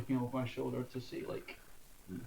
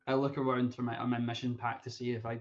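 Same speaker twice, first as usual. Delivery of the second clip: ordinary talk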